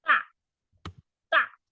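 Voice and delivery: 大 high and falling, said twice; 大 fourth tone, stressed. Shown as a demonstration. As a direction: model 大 with a strong fall, slowly. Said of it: "大，falling tone".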